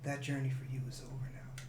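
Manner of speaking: soft whispered oracle line; whispered prophecy. Acted. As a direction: breathe it like a secret prophecy.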